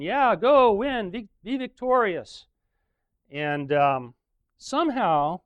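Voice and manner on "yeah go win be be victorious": high, loud mock cheer from the false prophets; cheering yell. Prophets cheering the king to battle, high mock yell. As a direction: cheer him on like a pep squad. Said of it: "They're all yelling".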